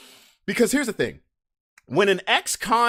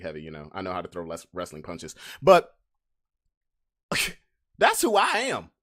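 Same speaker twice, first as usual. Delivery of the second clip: ordinary talk, nothing marked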